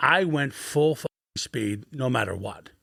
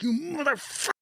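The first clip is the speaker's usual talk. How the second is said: mock enraged snarl; 12 semitones higher, 6 dB quieter than usual. Retold choking a teammate, enraged.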